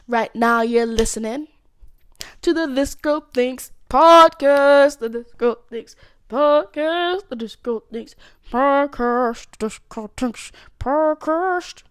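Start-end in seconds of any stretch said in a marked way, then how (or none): 2.4-11.8 slow, drawn-out announcer voice, louder at first; radio jingle voice. Pretend. Hosting her podcast, hammed-up announcer ident. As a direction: stretch each word like a station ident.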